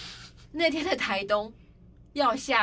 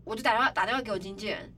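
ordinary talk

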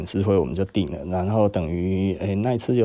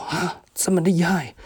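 amazed; 9 semitones higher than usual. Retold or imitated. Supplier amazed at sales.

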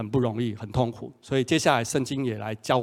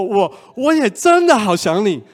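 higher, louder, slow stammered reply; stammering lovesick reply; 7 semitones higher, 7 dB louder than usual. Retold or imitated. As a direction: shout your longing back through the storm. Boyfriend answering in storm. (高声、结巴的深情回应)

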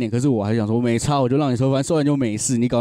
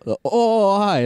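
awkward surprise; 8 semitones higher, slower than usual. Retold or imitated. Cop greets awkwardly.

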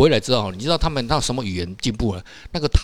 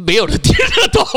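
laughing out the words, higher and louder; 7 dB louder than usual. Real, not acted. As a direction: crack up as you admit nobody got it. Nobody understood his Chinese.

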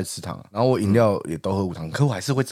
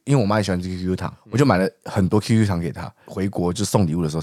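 usual speaking voice

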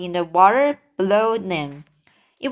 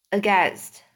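ordinary delivery, nothing marked

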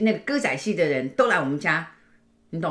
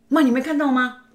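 put-on insistent child; 8 semitones higher, 6 dB louder than usual.

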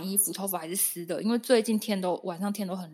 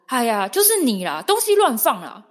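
mom scolding; 4 semitones higher, 9 dB louder than usual. Retold or imitated. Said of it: "我妈就直接说".

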